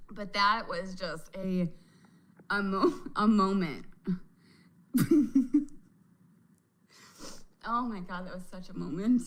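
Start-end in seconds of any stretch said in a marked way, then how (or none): 4.9-5.8 short loud burst of laughter. Real, not acted. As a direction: laugh out at the memory. Laughing at ride clip.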